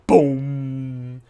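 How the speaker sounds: loud, drawn-out booming drum hit. Acted. Game master imitates the slap of a war drum, booming. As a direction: boom it out and let it ring. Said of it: "this slap of a drum".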